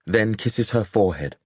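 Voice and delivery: usual speaking voice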